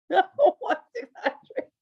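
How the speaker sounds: drawn-out giggling demand. Retold or imitated. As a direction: push it again through laughter. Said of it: "I said".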